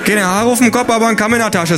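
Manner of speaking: a high, cheeky Berlin landlady; Berlin dialect. A narrator's reading. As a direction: throw a Berlin jibe. Hertha insults.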